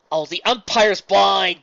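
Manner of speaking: slow, high, loud drawn-out heckle; heckling shout. Acted. Fan heckling the umpire from the stands, slow loud shout. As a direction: stretch the jeer out loud.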